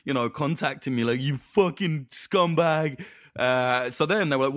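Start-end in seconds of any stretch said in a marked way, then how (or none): 1.2-3.0 slow, raised mocking voice; mock angry mums. Mimicking wine mums messaging him, slow mock contempt. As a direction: sneer it like the angry followers.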